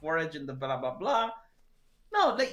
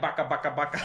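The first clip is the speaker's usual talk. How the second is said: mock gunfire; 5 semitones lower, faster than usual. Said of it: "you're going to go like".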